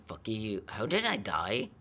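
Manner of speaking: ordinary talk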